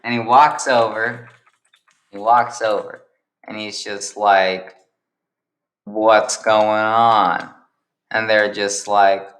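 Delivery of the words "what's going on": drawn-out question. Acted. Josh walks over to the picnic, asking very slowly. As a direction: stretch every word out.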